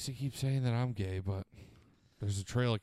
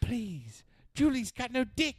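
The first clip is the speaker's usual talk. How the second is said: high servant voice; 13 semitones higher, slower than usual. Pretend. Continues servant voice.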